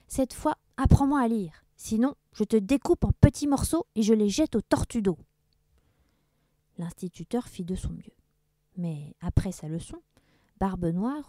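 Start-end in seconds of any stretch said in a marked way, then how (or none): 0.1-5.2 louder, higher angry order; pirate threat. Acted. Blackbeard orders the teacher, angry threat. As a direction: threaten him to teach properly.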